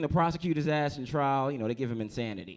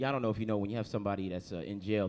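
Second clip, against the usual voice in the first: ordinary talk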